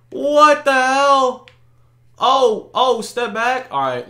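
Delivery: normal talking voice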